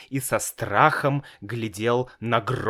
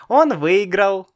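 put-on high little boy's voice, much higher; child voice; 9 semitones higher, 5 dB louder than usual. A narrator's reading. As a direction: play a small boy, innocent. Child reply.

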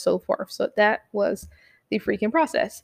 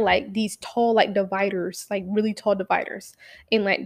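ordinary talk

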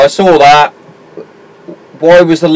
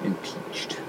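whisper; 5 semitones lower, 22 dB quieter than usual. Real, not acted. Whispered answer to "what that means.

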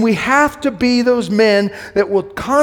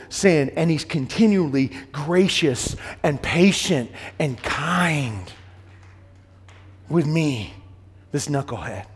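tender hush; 7 semitones lower, 7 dB quieter than usual. Real, not acted. Preacher softens about Jesus' grace.

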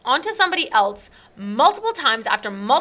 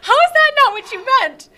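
laughing disbelief; 12 semitones higher, 4 dB louder than usual. Retold or imitated. Laughing disbelief at his reply.

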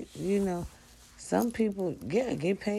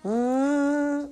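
high belted note; 11 semitones higher, 6 dB louder than usual. Pretend. Loud sung note.

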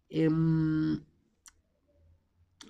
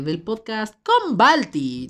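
louder, higher, drawn out show name; excited; 4 semitones higher, 7 dB louder, slower than usual. Shown as a demonstration. Host retrying the show name, excited and slow. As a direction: announce it with big excitement. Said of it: "sonó muy emocionado".

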